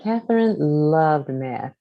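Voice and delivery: plain reading voice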